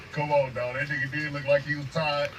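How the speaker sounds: ordinary talk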